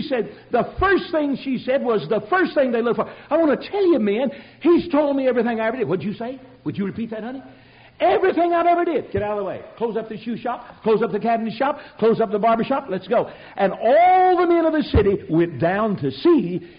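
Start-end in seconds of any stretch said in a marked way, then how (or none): 3.3-5.9 high, loud and thrilled; excited proclaiming. Retold or imitated. The changed woman telling the town men, high excited call. 8.0-9.1 high, loud, thrilled repeat; excited cry. Retold or imitated. Woman repeating her news to the men, loud excited cry. 9.1-13.3 eager rush. Retold or imitated. Men hurrying off to see.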